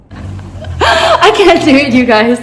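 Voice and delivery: high, slow laughing refusal; nervous laugh. Real, not acted. Vlogger backing away from the rat, laughing nervously. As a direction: laugh it out, too grossed out to go on. Too scared to show rat.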